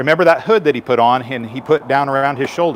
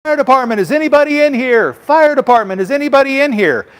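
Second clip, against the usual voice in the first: higher, louder search call into a smoky room; 11 semitones higher, 4 dB louder than usual. Shown as a demonstration. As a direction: call out to find anyone trapped. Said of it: "they may be yelling for you".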